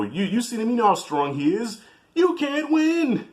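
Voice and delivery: high, pleading wife's voice, slowing down at the end; doubting Adrian. Retold or imitated. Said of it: "had that moment of".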